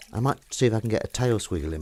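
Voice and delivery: ordinary talk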